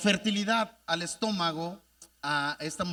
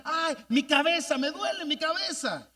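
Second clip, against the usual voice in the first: put-on higher, pained cry of a sick boy; mock cry of pain; 7 semitones higher than usual. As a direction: call out the headache in distress. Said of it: "empezó a gritar".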